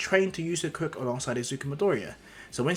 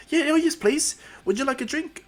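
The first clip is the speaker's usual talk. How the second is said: high-pitched eager hostess; high, eager welcome; flustered mother; 13 semitones higher, 4 dB louder than usual. Acted. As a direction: offer hospitality in a high mum voice. Flustered mother invites hero in.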